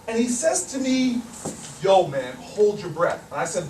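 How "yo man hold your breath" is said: low, even command in a big man's voice; low-voiced order. Big man orders a student to stop breathing, low-voiced. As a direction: give the order low and matter-of-fact.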